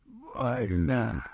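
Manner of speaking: thin, drawn-out wail of pain; cry of pain. A narrator's reading. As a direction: wail it without volume. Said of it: "закричал Булыжников". (стонет от боли)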